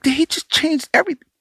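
high, incredulous retold question. Producer quoting the rapper amazed by changed rhymes, incredulous. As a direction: voice leaping up in mock disbelief. Acting out [name]'s stunned reaction.

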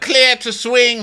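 high, loud, slow deck call. Acted. [name] calling to the captain, loud deck call. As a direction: holler it across the deck, drawn out.

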